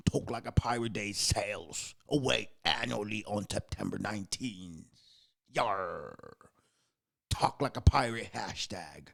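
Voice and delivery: a touch of pirate in the read; light pirate voice. Acted. Host reading the pirate day blurb in a light pirate voice. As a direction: read it like a lazy buccaneer. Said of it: "We're gonna talk like pirates today".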